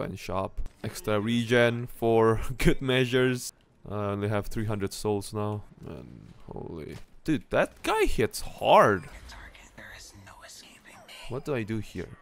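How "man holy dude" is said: ordinary talk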